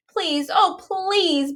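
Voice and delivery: high, pleading please; begging. A narrator's reading. Stella begging Sal to still have the book. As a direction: beg him desperately. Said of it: "begged Stella".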